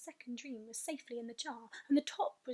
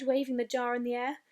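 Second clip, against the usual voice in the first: ordinary delivery, nothing marked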